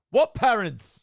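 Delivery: loud, high mock-British outburst; mixed-up British accent. Muddling the voices of [name] and [name], British accent. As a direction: exclaim it in a hammed-up British accent. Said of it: "[name] became British".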